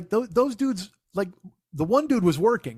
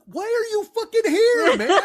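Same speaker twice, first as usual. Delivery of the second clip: incredulous; 6 dB louder, slower than usual. Retold or imitated. Incredulous at early-arriving bouncer.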